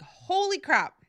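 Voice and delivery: sudden high, loud, slow gasp of disbelief; stunned at seeing her on the big screen; holy crap surprise. Retold or imitated. Seeing Tanya on the big screen, a loud high stunned exclamation. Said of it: "And I'm like".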